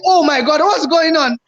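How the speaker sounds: 2 high, alarmed cry; 1 retold panic. 4 creator seeing zero subscribers, high alarmed cry.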